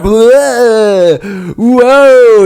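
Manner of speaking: mock awed whoa. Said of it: "he's like".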